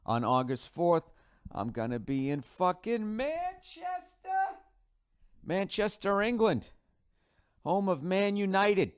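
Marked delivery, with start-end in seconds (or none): 1.5-4.3 high, city name drawn out long; hammed-up announcement. Announcing tour city with hammed-up gusto. 5.4-8.9 high, punchy city announcement; hammed-up announcement. Announcing tour city with hammed-up gusto.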